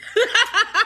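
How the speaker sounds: a sudden squealing cackle; high shrieking laugh. Real, not acted. Burst of laughter.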